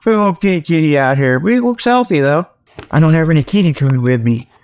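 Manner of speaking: usual speaking voice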